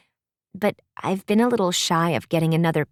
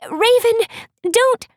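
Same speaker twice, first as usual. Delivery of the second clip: high, loud urgent plea; yelled plea; 5 dB louder, slower than usual. Acted. Raven warned as the prince charges, urgent yell. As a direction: cry out to stop her in time. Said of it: "Raven yelled".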